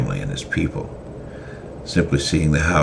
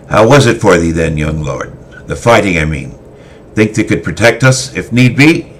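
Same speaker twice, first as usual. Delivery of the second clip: louder, higher questioning; a veteran asking his pupil about the fighting; gruff swordmaster asking; 4 semitones higher, 8 dB louder than usual. Acted. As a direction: press the young lord for an answer. Christoph asks Zealand.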